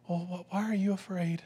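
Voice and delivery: mock the sweet storybook Jesus; gentle Jesus voice. Bible-story Jesus to frightened disciples, put-on gentle and soft. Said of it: "tone in Jesus' vo-, voice".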